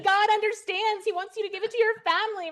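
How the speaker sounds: high-pitched comic outburst; exasperated mock plea. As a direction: plead in a high, half-laughing yelp, as if at a stubborn friend. Exasperated inner voice pleading with friend.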